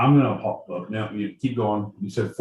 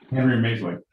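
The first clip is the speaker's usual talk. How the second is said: normal talking voice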